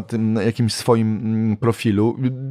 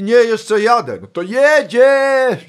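very high, loud, very slow; a star urging the crowd on; mock star cheer; 12 semitones higher, 6 dB louder, much slower than usual. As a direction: stretch it out grandly. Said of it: "A on mówi".

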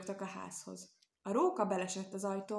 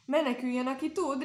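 higher, louder frightened shriek; a young piglet shrieking for everyone to flee; 5 semitones higher, 5 dB louder than usual. A narrator's reading. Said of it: "rikoltotta egy ijedős".

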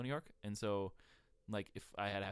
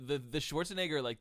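normal talking voice

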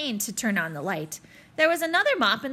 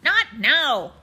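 loud, higher holler; 4 semitones higher, 7 dB louder than usual. Acted. Principal cutting off the Texas salesman with a loud holler. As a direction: brush him off with a holler. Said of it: "Barkin hollered".

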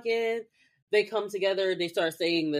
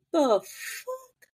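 high, baffled; mock baffled; 10 semitones higher than usual. Host voicing Prue reacting, baffled. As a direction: say it totally thrown.

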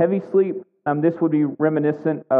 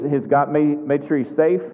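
normal talking voice

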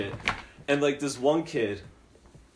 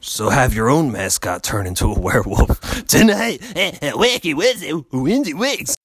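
loud promo announcer plug; host plugging the live show; promo read; 8 dB louder than usual. Acted. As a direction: sell the show night.

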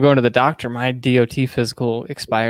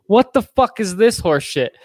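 mock outrage; 8 semitones higher than usual. Retold outrage at old nurse.

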